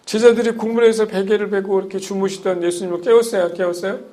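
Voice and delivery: ordinary talk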